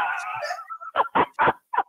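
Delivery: shrill burst of laughter, way up high; high cackling laugh. Real, not acted. Caller cackling at her own roast of the singer. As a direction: laugh hard at your own joke.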